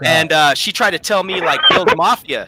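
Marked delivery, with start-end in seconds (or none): none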